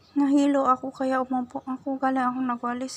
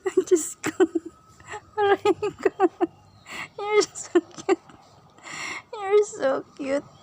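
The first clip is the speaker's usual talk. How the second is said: gushing squeal; 8 semitones higher, slower than usual. Real, not acted. Gushing over pet.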